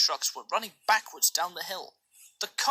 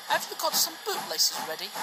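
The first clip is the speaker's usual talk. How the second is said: cheeky engine; 8 semitones higher than usual. A narrator's reading. Cheeky tank engine teases.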